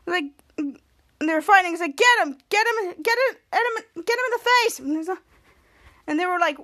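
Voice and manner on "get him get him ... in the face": high-pitched repeated cheer; retold egging-on. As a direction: imitate onlookers urging on a fighter. Retold onlookers egging on fight.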